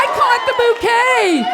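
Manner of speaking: hammed-up triumph; thrilled shout. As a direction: announce it like winning the lottery. Catching bouquet, thrilled.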